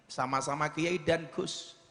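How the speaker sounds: ordinary delivery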